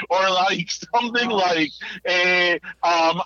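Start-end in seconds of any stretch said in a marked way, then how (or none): none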